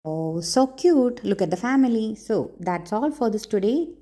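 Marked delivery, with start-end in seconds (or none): none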